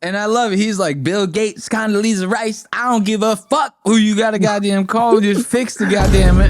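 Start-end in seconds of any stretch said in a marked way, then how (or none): 1.0-6.5 higher, louder mock outrage; mock-angry rant, pitched up; pissed-off rant imitation. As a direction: imitate an exasperated gamer going off. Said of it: "He's so pissed".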